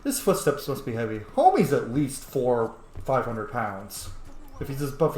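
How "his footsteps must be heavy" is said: normal talking voice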